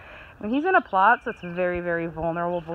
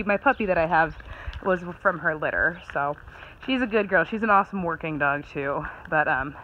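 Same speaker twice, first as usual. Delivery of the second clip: ordinary talk, nothing marked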